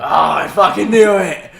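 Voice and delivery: delighted laughing shout; laughing triumph. Real, not acted. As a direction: laugh it out, caught him. Guessing friend's favorite swear word.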